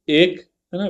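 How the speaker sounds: ordinary talk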